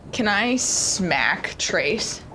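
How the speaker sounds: normal talking voice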